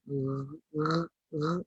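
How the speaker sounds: soft sustained GH from the throat; throat-made ghin. Shown as a demonstration. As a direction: softly rasp R at the back of the tongue. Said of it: "makhraj halqum kat sini". (bunyi ghin dari halqum)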